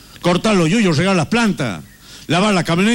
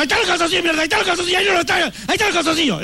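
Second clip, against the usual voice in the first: high, fast exasperated scolding; nagging wife; 11 semitones higher, much faster than usual. Retold or imitated. Husband mimics his wife snapping about his underwear, high. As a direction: rattle off the answer like an annoyed wife.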